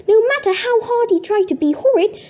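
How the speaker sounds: plain reading voice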